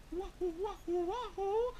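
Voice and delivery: high, wavering bird call; high little nervous hoot; a little scared. Acted. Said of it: "he was a little scared".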